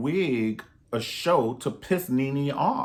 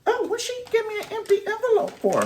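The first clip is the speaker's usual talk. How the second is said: much higher put-on voice of the recipient; mock puzzled recipient; faster than usual. Comic voicing a rival opening Wendy's envelope, high mock puzzlement. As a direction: squeak it out, baffled.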